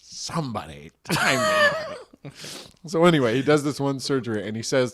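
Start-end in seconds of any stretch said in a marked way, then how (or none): 0.0-2.3 high, slow cartoon showman voice; hammed-up, high-pitched mask impression. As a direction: draw it out like a gleeful masked showman. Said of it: "in the mask voice".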